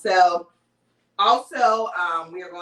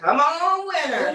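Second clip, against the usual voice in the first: hyped giveaway announcement; 7 semitones higher, 3 dB louder than usual. Real, not acted. Spinning the giveaway wheel.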